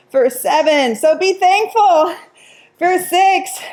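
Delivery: high, drawn-out urging; effortful encouragement. Real, not acted. Coach urging class mid-countdown, high effortful cheer. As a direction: push through the count, pitched high.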